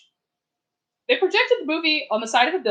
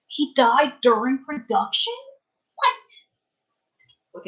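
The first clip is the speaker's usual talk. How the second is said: shocked disbelief; 3 semitones higher, much slower than usual. Real, not acted. Shocked by chat fact.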